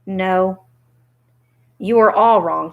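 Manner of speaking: ordinary delivery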